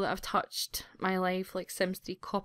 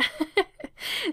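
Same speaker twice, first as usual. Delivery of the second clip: a high, tearful little laugh; embarrassed laugh while drying her eyes; teary laugh; 11 semitones higher than usual. Real, not acted. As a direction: laugh through the tears. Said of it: "I'll just dry my eyes".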